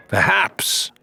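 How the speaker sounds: high mocking sneer; Ramsay sneering at the pirate. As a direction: sneer the word out.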